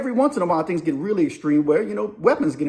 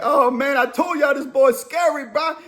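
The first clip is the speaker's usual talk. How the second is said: higher, hollering taunt; mock yell; 8 semitones higher than usual. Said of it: "And Slim's like".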